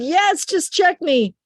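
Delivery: high, slow insistent plea; urgent plea. Retold or imitated. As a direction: imitate a mother demanding help. Retold mother answers urgently.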